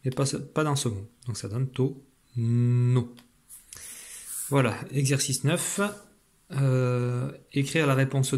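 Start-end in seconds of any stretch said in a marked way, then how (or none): 1.7-3.1 "to, no" as two separate syllables, the n held; syllables sounded out. Shown as a demonstration. Teacher showing o and n not joined, sounded out. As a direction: lengthen the n of "no" after a clear "to". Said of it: "il faut les lire ensemble".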